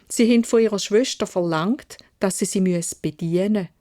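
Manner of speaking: plain reading voice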